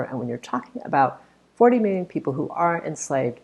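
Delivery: ordinary talk, nothing marked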